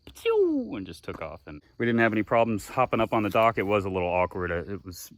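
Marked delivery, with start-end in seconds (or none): 0.1-0.8 high, loud splash noise; imitated splash. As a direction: shout a sudden splash sound. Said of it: "she was like".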